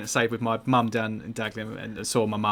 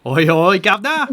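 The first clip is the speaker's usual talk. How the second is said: mock Cockney; 6 semitones higher, 9 dB louder than usual.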